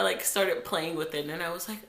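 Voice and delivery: usual speaking voice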